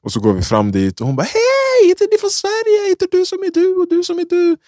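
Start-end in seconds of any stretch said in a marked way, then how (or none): 1.2-4.6 put-on very high, squealing excited girl; mock high-pitched excited girl. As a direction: squeal it like a thrilled fan. Girl voiced in retold story.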